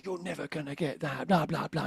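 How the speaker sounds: hushed, undermining inner voice; quiet inner critic. Retold or imitated. Inner critic doubting himself, said quietly and undermining. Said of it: "Before my voice would say".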